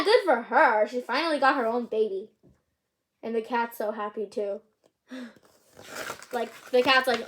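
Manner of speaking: plain reading voice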